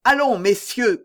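rally the men around you; king's rallying cry. A narrator's reading. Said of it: "cria le roi". (appel royal lancé d'une voix haute)

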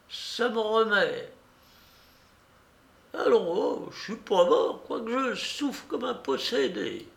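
put-on high, halting sick old man; old beggar. Suffering beggar reviving after snuff, high old voice. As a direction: groan along in a high old voice.

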